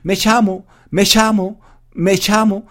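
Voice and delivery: Argentine "me llamo" with ll said as "ch"; Argentine accent. Retold or imitated. Argentine girl introducing herself to a classmate, in her Argentine accent. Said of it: "Ella decía algo así como".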